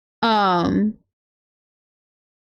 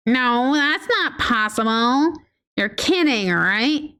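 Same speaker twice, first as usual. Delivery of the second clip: ordinary talk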